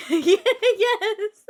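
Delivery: squealing yes through laughter; laughing yes. Real, not acted. Bursting into laughter.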